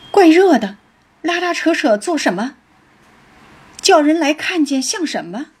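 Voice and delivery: lightly annoyed, high questioning; irritated brush-off. A narrator's reading. Qingwen brushes off Baoyu's pulling.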